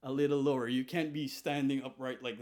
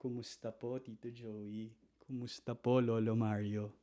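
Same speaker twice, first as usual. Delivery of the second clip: much softer, humble, rising gently on po; deferential greeting; 3 semitones lower, 6 dB quieter than usual. Shown as a demonstration. Greeting fiance's elders in Tagalog, soft and respectful. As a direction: lower your voice as if bowing to an elder. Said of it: "But in Tagalog".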